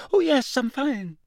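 high, unnatural and hurried; gabbling, high voice. A narrator's reading. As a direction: babble brightly to hide the shock. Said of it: "Neville gabbled in the same unnaturally high voice".

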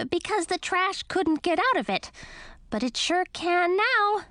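high, lively little bat. Acted. Lil the bat speaks.

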